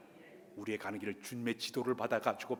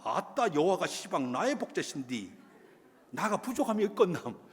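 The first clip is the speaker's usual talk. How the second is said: Jeolla dialect; 6 semitones higher, 5 dB louder than usual. Retold or imitated. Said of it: "전라도 사람".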